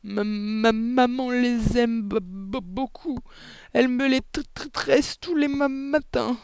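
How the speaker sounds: put-on high, slow little-girl stutter; terrified stammering. A narrator's reading. Amanda answers the headmistress about her mother, stammering in fear. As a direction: stumble on each word, frozen with fear. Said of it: "parvint à balbutier".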